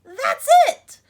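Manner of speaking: very high, very loud eureka; thrilled Nellie. Acted. Nellie discovering what to do with the squash, loud and thrilled. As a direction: burst with the discovery. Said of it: "said Nellie".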